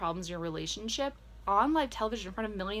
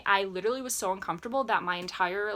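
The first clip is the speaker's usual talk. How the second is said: ordinary delivery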